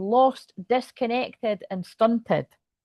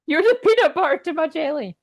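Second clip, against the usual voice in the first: high-pitched, over-sweet declaration; gushing card read-out; 9 semitones higher, 6 dB louder than usual. Acted. Reader voicing a love card to the beloved, gushing. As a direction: sing out the love line high and loud.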